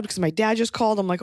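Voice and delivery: ordinary talk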